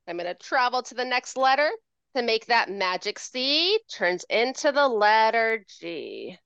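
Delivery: usual speaking voice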